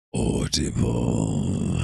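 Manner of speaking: very low, dragged-out and loud; deep slow voice. Pretend. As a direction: stretch the word out deep and slow. Joking while moving the mic.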